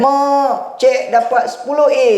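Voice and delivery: high, bright call to his mother; a child running in with big news; excited call. Retold or imitated. Son calling his exam results to mother, high and excited.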